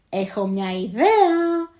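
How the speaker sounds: loud, slow, eager call; shouting an idea. A narrator's reading. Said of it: "φωνάζει τότε ο Λέανδρος". (φωνάζει ενθουσιασμένος)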